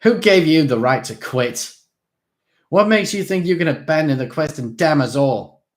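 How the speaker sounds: angry scolding of a quitter; furious accusation. A narrator's reading. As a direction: storm at him, pointing. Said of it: "I stormed past".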